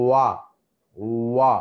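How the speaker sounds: వా with lips fully rounded into a circle; rounded wa demo. Shown as a demonstration. Teacher demonstrating the rounded వా to students, lips fully rounded. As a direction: sound out వా slowly, lips pushed round.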